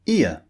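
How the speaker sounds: "ear" glided from wide to relaxed; model diphthong. Shown as a demonstration. Teacher modelling the diphthong, gliding. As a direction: stretch "ear" as two vowels. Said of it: "the next two vowel sounds are".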